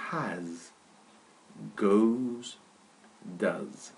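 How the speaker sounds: has, goes, does repeated as a clear model; teacher repeats has, goes, does; careful pronunciation. Shown as a demonstration. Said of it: "Listen carefully to the pronunciation".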